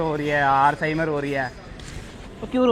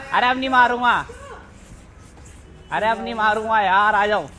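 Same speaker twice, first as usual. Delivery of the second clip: pleading; 7 semitones higher than usual. Real, not acted. Pleading she come back.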